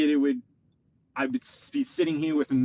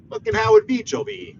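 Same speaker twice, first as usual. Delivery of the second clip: fed-up outburst; 8 semitones higher, 6 dB louder than usual. Real, not acted. Cursing the neighbourhood.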